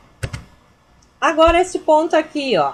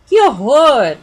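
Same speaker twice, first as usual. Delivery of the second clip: much louder horrified cry; 9 dB louder, slower than usual. Shown as a demonstration. Teacher reading an exclamation of horror, horrified. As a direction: recoil at it. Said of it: "sample exclamation of horror".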